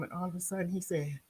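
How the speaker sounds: ordinary talk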